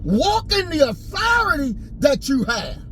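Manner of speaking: high, rising shout, hammering the point; impassioned sermon shout; fiery preaching. Real, not acted. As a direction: preach it hard at them. Preaching to listeners, rising intensity.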